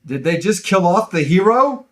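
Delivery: high, incredulous outburst; exclaimed question. Acted. Host reading a listener's punctuation-heavy review, incredulous. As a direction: read it as a shocked, rising question. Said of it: "a lot of exclamation points and question marks".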